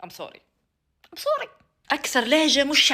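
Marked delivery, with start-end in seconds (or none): 0.0-0.4 low, soft mock apology; muttered sorry. Vlogger apologising between segments: low soft sorry. Two contrasting sorry takes. 1.1-1.5 very high, softer cutesy sorry; squeaky sorry. Pretend. Vlogger apologising between segments: very high cute sorry. Two contrasting sorry takes.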